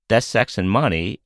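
normal talking voice

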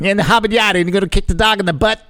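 high, mocking Boston skeptic; Boston accent. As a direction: tease them in a thick Boston accent. Continues Boston skeptic quote.

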